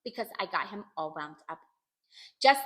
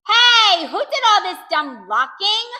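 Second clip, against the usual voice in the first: high, loud, cross demand; indignant shout; 9 semitones higher, 13 dB louder, slower than usual. A narrator's reading. Child facing locked doors demands answers, indignant. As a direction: yell it at whoever is to blame.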